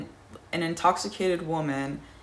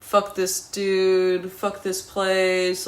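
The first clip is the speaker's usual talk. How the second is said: slow, drawn-out mock shouting, louder and higher; retold angry outburst; 4 semitones higher, 4 dB louder, slower than usual. Narrator venting at the bouncer, slow mock yell. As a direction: spit it out slowly like venting at the bar. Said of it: "I start saying, like, mindless stuff".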